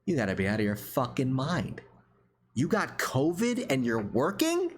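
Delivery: disbelief. Retold or imitated. Telling the sick tow driver off in rising disbelief. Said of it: "And I'm just like".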